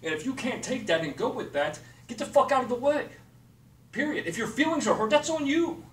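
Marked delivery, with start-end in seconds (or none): 2.1-5.8 raised, higher and louder, telling them off; angry outburst. Real, not acted. To people who won't take his direction, angry outburst. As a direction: throw it at them, done with excuses.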